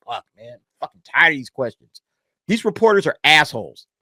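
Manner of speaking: ordinary talk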